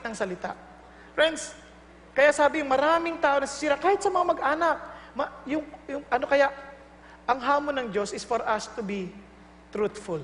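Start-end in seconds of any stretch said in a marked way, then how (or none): none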